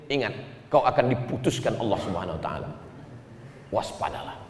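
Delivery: low, soft warning, dropping almost to a whisper; hushed warning. Acted. Preacher warning unjust judges, hushed. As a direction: drop right down and caution them.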